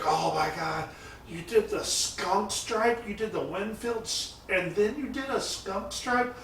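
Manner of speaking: high-pitched mock yell of amazement; high, incredulous impression of an excited painter; imitating excited yelling. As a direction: play a hyper friend freaking out in disbelief. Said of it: "He's just yelling at me".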